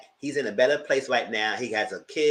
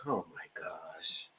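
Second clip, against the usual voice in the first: stunned hush; 9 semitones lower, 16 dB quieter, much slower than usual. Retold or imitated. Stunned reaction retold.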